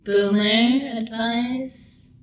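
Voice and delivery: drawn-out Gen Z American drawl; Gen Z character. Acted. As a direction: dismiss it as a Gen Z type, stretching the words. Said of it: "I'm a Gen Z".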